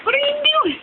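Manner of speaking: high-pitched kitten question. Real, not acted. Cooing at kittens.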